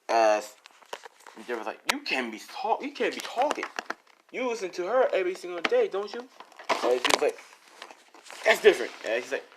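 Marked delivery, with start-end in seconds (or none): 1.9-6.3 high-pitched, indignant comeback; mock indignant retort. Endeavor arguing back at Deku, indignant high retort. Acted quarrel between rivals. 8.4-8.9 loud, high defensive outburst; defensive protest. Acted. Man defending himself to rival, loud high protest.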